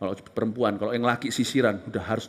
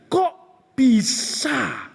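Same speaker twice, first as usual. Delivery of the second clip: high, drawn-out kok bisa in disbelief; incredulous exclaim; 10 semitones higher, 3 dB louder, much slower than usual. Acted. (bisa, nada tinggi tak percaya)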